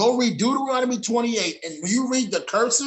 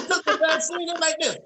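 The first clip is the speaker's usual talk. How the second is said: high strained voice of a twisted face; mimicking a palsied face, pitched up; 8 semitones higher than usual. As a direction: squeeze the words out past crooked lips. Imitating vaccine victims' faces.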